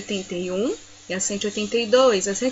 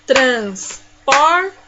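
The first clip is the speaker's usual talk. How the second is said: syllable by syllable; 5 dB louder than usual. Shown as a demonstration. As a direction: split TRANSPORTE into three loud beats. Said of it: "E assim eu separei as sílabas".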